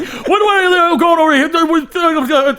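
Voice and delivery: high, loud spluttering grandpa rant; mock freak-out. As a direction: imitate an old man losing it. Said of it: "just freaking out".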